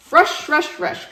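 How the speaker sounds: high, loud grumble; complaining. Acted. Yak complains to Rabbit, high and grumbling. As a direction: scoff at the hurry. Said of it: "complained Yak".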